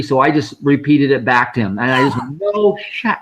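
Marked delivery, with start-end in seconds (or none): 2.4-3.2 cheeky high echo of his words; spunky echo. Retold or imitated. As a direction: fire it right back at him playfully. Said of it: "I was feeling particularly spunky".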